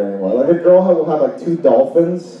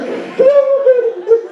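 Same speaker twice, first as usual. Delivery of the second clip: very high mock shriek of a burning vampire. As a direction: screech like a vampire hit by sunlight. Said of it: "pretend like I was a vampire being hit by the sun".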